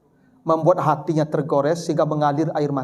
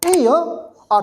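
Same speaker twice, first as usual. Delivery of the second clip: ordinary delivery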